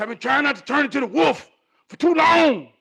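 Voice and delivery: loud, high-pitched venting; exasperated protest. Acted. Husband fed up with holding back, exasperated protest. Exasperated argument with his wife.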